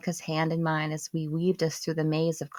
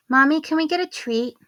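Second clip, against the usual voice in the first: put-on high, louder pleading child voice; little girl asking; 12 semitones higher, 6 dB louder than usual. Daughter asking mommy for a treat, high child voice. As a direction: beg sweetly like a small child. Young daughter speaking in story.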